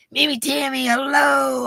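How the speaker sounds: high, bright name call; happy shout-out to a viewer; excited hello. Real, not acted. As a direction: sing out the name.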